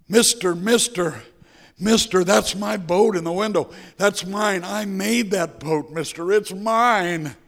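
put-on pleading boy. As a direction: voice of a boy claiming his boat.

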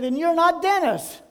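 loud, high comeback; acted surprise. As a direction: throw the surprise right back, as a punchline. Stranger acts out matching realization.

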